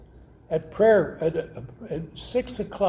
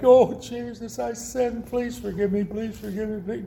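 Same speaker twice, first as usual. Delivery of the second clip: mock begging; 4 semitones higher than usual. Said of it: "You don't have to beg".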